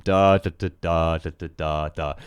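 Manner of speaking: "da, da, da" as long-short-short, closing on "da, da"; chanted hexameter beat, "da" long then two quick; dactylic rhythm. Shown as a demonstration. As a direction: beat out "da, da, da" with the first held, sinking in pitch. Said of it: "gonna hear that rhythm".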